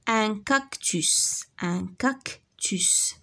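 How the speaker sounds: very slow, drawn out; slow modelled word. Shown as a demonstration. Teacher modelling a word for children, slowly. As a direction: sound it out for the class. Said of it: "teacher models word slowly".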